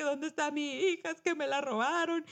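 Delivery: ordinary talk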